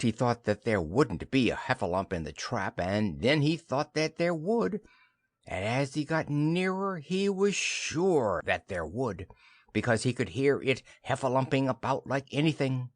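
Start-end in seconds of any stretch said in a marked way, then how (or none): none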